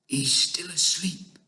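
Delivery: slow, hushed giant whisper. A narrator's reading. BFG to Sophie beside sleeping giant, whispered. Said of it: "the B F G whispered".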